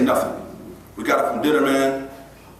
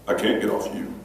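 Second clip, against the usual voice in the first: low, soft, unhurried calm voice; zen calm staffer; 10 semitones lower, 5 dB quieter than usual. Retold or imitated. Calm staffer explaining to the struggling boy, low and soft. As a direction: answer with total calm. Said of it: "he is zen calm".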